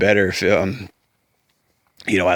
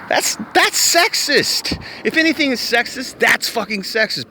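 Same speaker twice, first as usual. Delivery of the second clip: indignant; 11 semitones higher than usual. Real, not acted. Ranting about a sexist double standard, indignant. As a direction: protest in a high, incredulous pitch.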